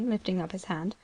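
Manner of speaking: plain reading voice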